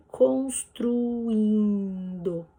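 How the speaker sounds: "construindo" slowly stretched over four syllables; slow teaching demonstration of "construindo". Shown as a demonstration. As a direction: draw out "construindo", separating U from I. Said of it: "separada com quatro sílabas".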